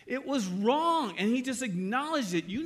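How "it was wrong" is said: higher, louder, slow insistence; firm retold correction. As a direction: hammer home that it was wrong. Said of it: "And I say".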